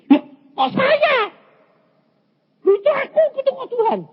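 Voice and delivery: very high, loud cry of disbelief; astonished reenactment. Retold or imitated. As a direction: squeal it out, acting stunned. Acts out astonishment before God. (seruan kaget bernada sangat tinggi)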